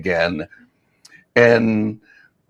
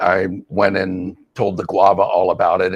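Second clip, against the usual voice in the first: normal talking voice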